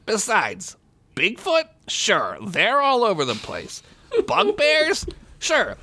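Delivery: high-pitched, swooping know-it-all; condescending. A narrator's reading. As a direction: scoff with squeaky questioning peaks. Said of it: "Peppercorn said condescendingly".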